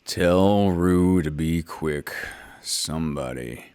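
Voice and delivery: low, slow, gloomy donkey grumble; grumbling Eeyore. A narrator's reading. Narrator voices Eeyore grumbling about washing, low and slow. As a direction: drag it out like a glum old donkey.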